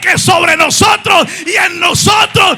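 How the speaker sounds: full-force pulpit declaration; preaching. Acted. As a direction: proclaim God's power boldly.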